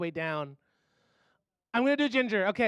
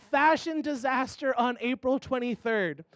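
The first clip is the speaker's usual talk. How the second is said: ordinary talk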